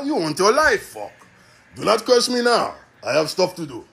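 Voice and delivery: blunt God in a mock Russian accent. God telling complaining humans off, Russian accent. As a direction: cut in with a thick-worded Russian accent. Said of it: "God being like a Russian, just cutting to the point".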